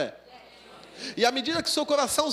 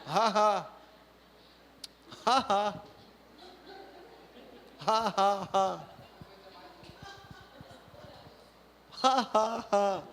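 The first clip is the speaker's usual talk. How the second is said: slow deliberate ha ha, lightly acted; forced laughter; 2 semitones lower, 3 dB quieter, slower than usual. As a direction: act out laughing on purpose while driving. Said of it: "e eu". (risada forçada e encenada)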